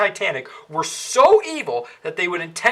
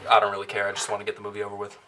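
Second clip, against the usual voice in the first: fast, fed-up mumble; 9 semitones lower, 4 dB quieter, much faster than usual. Real, not acted. Fed up with the film.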